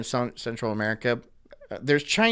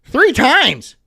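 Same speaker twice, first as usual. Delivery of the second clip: loud, high, exasperated ranting; furious disbelief, the last slow and hammered; angry rant; 10 semitones higher, 8 dB louder, slower than usual. Real, not acted. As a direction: blow up at how dumb it is. Ranting about idiots.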